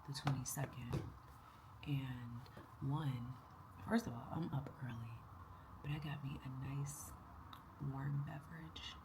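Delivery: soft early-morning whisper. Real, not acted. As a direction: whisper so no one wakes.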